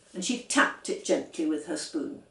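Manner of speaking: plain reading voice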